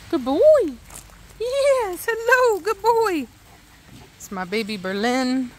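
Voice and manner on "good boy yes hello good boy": high-pitched cooing to a pet; doting dog talk. Real, not acted. Owner greeting the dog, doting high voice. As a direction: praise a beloved dog in a high voice.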